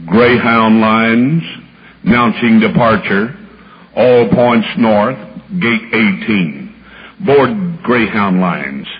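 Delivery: low, loud, slow drawn-out announcement; booming slow depot PA voice; bus station announcer. Retold or imitated. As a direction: call the departures like a loudspeaker.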